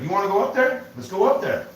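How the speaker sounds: a coworker losing patience, calling the bluff; irritated challenge. Retold or imitated. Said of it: "getting irritated".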